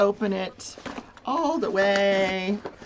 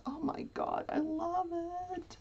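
hushed delight; 8 semitones higher, 11 dB quieter than usual. Real, not acted.

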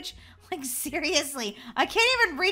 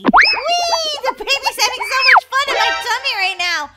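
high, loud gleeful whee; excited squeal; 10 semitones higher, 11 dB louder than usual. Acted. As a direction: squeal with delight down the slide. Sliding down fun house.

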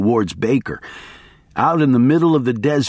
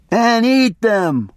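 plain reading voice

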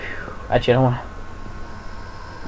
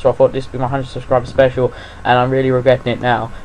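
ordinary delivery

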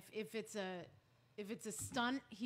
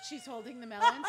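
ordinary talk, nothing marked

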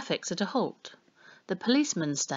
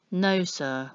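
plain reading voice

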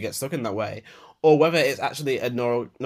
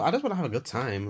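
normal talking voice

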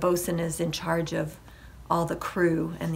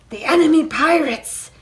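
urgent, raised hiss, more voiced than whispered; hissed warning; 8 semitones higher, 10 dB louder than usual. A narrator's reading. Jack alerts his mate to the enemy, urgent mild hiss. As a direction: warn your mate in alarm. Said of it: "hissed Jack to his mate".

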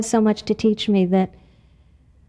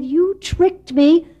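higher, louder slow accusation; angry mouse; 8 semitones higher, 3 dB louder, much slower than usual. Acted. Wet little mouse accusing Frog, angry and high. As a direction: spit out the betrayal. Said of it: "he was very angry".

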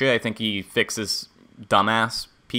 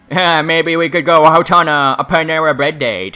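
raised, louder put-on suitor voice; acted date invitation; 4 semitones higher, 6 dB louder than usual. Suitor to a girl after her breakup, acted invitation. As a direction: play someone asking her out. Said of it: "Then you tell her, like, Hey".

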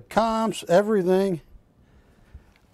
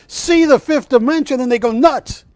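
higher, louder, spooky warning; 8 semitones higher, 6 dB louder than usual. Retold or imitated. Artist warning Barry before the reveal, hammed-up loud. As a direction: ham up the artist's dramatic warning. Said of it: "and he goes".

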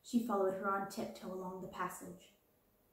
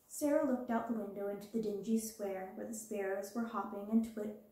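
ordinary delivery, nothing marked

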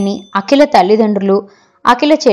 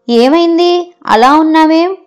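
lightly voiced, higher-pitched questions; concerned granddaughter; 7 semitones higher, slower than usual. A narrator's reading. Granddaughter asking her downcast grandmother what is wrong, lightly voiced concern. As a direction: ask with a child's lifted, lilting concern.

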